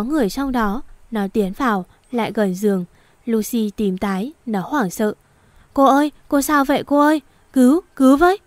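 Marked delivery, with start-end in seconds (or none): 5.7-8.4 frantic, louder and higher, fast cry for help; panicked shout. Acted. Finding Lucy unconscious, shouting panicked for help. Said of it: "mày hét to thế".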